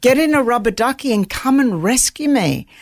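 mock outrage with a laugh; exasperated disbelief. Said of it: "I'm like".

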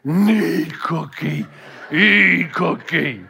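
slow, low, gruff-free monster grunting each word; Cookie Monster voice. Retold or imitated. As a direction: plod out the words like a hungry puppet monster. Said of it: "The Cookie Monster says".

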